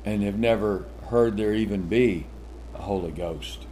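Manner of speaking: ordinary talk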